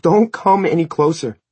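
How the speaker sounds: loud, high-pitched terrified warning; voice breaking. A narrator's reading. Mother backing away from her son, panicked shout. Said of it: "her voice broke".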